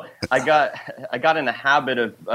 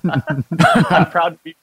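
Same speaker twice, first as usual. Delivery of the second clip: laughing, slow, louder; 6 semitones higher, 4 dB louder, slower than usual. Real, not acted. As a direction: laugh through the words. Guest laughing through thanks.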